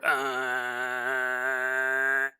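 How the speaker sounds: low drawn-out grinding drone; boulder sound. Retold or imitated. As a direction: imitate a stone door sliding open. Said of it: "when you hear that sound".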